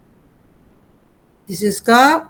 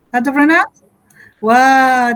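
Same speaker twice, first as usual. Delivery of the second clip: ordinary talk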